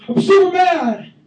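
high, loud slow put-on rage; hammed-up mad shout; mock mad Jesus. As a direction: ham up the anger. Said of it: "He's like".

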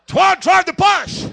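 loud, slow, high-pitched order; exasperated full-voice shout; shouted command. Retold or imitated. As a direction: yell it word by word, no arguing. Pastor overrides Twan's protest.

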